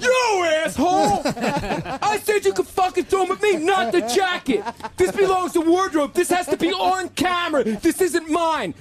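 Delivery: high, drawn-out mock outrage; Ben Affleck. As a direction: play an indignant star throwing a fit. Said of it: "Ben Affleck misses with his fucking great".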